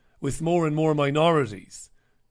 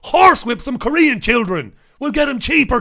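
louder, higher, hammed-up punchline; mock outraged joke; 7 semitones higher, 8 dB louder than usual. Joking about Tez's cheap workers, loud hammed-up delivery. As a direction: push the joke out big.

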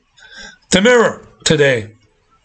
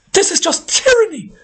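much higher, squealing mock shock; mock shocked outrage; 13 semitones higher than usual. Shown as a demonstration. Ranting host mocks tyranny outrage, shrill mock shock. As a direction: send the voice way up in fake horror. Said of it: "The voice must go up several octaves to convey your shock".

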